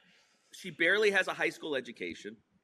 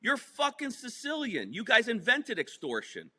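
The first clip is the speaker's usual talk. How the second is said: incredulous retort; 9 semitones higher than usual. Retold or imitated. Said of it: "I said".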